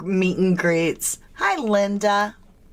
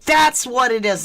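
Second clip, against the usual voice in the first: normal talking voice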